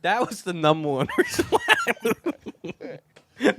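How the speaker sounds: high, slow, breaking into laughter; laughing admission. Real, not acted. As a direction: laugh your way through a joking confession. Joking about his reason for moving.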